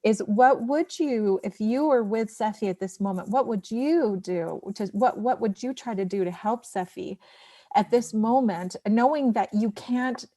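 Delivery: ordinary delivery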